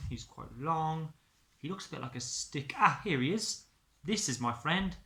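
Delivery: ordinary delivery, nothing marked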